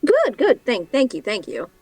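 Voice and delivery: high, friendly cab driver. Acted. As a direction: play the chatty cab driver. GM voices driver.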